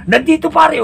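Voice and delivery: high, shouted boast; high excited drunk voice; proud drunk buddy. Acted. Buddy shows off his bottle.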